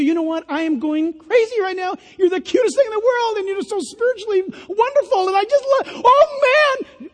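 high, fast breathless gushing; gushing infatuation. Acted. The girl gushing at her boyfriend, high and excited. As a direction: swoon over him.

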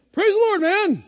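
hearty exclamation. Retold or imitated. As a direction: boom it out like a converted cusser. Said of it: "he goes".

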